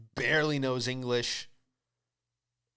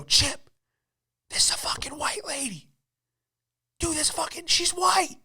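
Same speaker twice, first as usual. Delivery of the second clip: high, hushed aside to a friend; strained, squeaky mock whisper; urgent stage whisper; 14 semitones higher than usual. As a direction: re-enact a scandalized whisper behind her back. Secretly alerting friend behind server's back.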